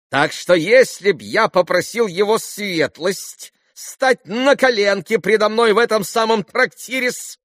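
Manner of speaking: high, loud, slow taunting question; shrilly pitched, incredulous buffoon; bitterly mocking captain. A narrator's reading. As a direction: challenge him in a high, agitated voice.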